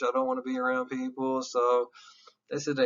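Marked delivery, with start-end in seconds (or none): 0.0-1.4 much higher, fast mock standoffish; high quick avoidant voice. Teacher voicing an avoidant person, high and fast. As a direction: brush people off in a high quick voice.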